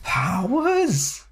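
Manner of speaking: high thrilled squeal; gleeful, pitch jumps way up; excited. Real, not acted. Excited about powers.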